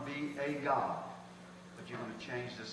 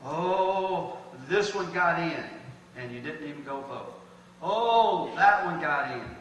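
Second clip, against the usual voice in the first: mock complaining; 2 semitones higher, 9 dB louder, slower than usual. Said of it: "mocking election complainers".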